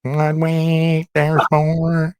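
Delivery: drawn-out, level-toned ad tagline; lilting mock commercial voice; Grocery Outlet ad. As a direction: stretch each word like a store slogan. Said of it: "Like the grocery outlet".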